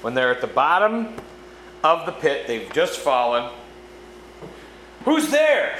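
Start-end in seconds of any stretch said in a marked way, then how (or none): 5.0-5.8 high, loud startled call of who's there; shouted question. A narrator's reading. Travelers at the pit bottom shouting into the dark. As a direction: call out into the dark, louder and higher. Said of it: "shouted the three travelers".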